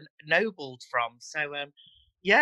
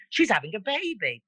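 put-on higher, posh "baby" with long a; posh accent; 4 semitones higher than usual. Shown as a demonstration. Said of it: "Baby's very posh".

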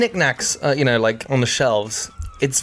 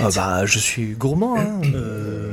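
lower, drawn-out French grumble; French accent impression; 6 semitones lower, slower than usual. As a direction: play a Frenchman savoring food. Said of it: "makes you sound more French".

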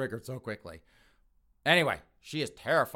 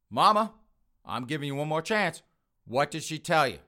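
raised, loud ultimatum; angry demand; 4 semitones higher, 5 dB louder than usual. Acted. Son giving his mother one more chance, angry demand. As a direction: press for an answer with mounting anger.

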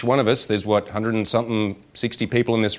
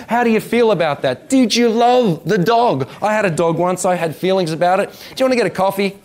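high-pitched, relentless exasperated rant; comic exasperation; 9 semitones higher than usual. Acted. Coach to tongue-tied physicists who run out of things, comic exasperation. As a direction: pile up the obvious questions in mock disbelief.

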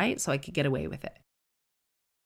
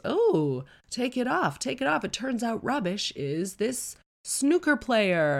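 higher-pitched, hyped-up imitation of a TV reveal; mock show reveal; 7 semitones higher than usual. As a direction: mimic a crowd egging on an unmasking. Mimicking the show's excited reveal.

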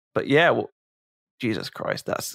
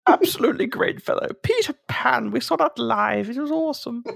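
mock old posh Brit; 10 semitones higher than usual. Continuing the old toff bit.

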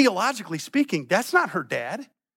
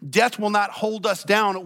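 ordinary talk

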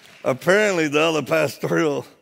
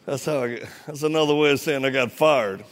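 normal talking voice

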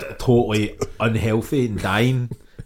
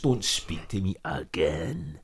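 low, very slow hushed warning; slow murmured "don't speak to me again"; imitating the threat; 6 semitones lower, 7 dB quieter, much slower than usual. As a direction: drag it out slowly, quietly and coldly. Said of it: "Most people just".

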